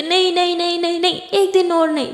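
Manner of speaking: put-on a little girl yelling in a tantrum; child shouting no. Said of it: "खुशी चिल्लाने लगती". (बच्ची का ज़िद भरा चिल्लाना)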